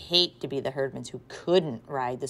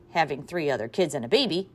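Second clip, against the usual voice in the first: ordinary delivery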